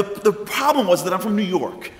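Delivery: usual speaking voice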